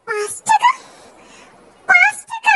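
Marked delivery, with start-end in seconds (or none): 1.9-2.5 appalled by the price, voice jumps up; outraged disbelief. Acted. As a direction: shriek the price back in shock.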